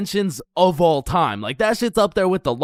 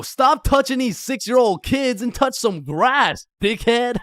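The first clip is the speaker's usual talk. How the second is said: higher, slow scolding; 6 semitones higher than usual. Acted. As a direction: tell them off slowly. Scolding commenters.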